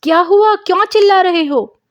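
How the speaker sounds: high, loud startled calling out; alarmed shout. Acted. Mother runs out at the scream, loud and high.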